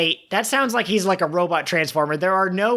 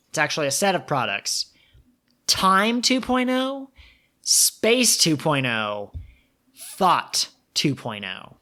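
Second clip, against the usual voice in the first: hushed reveal. Acted. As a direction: breathe out the names like a secret launch. Dramatic product reveal in ad.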